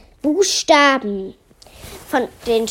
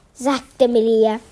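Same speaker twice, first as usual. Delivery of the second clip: plain reading voice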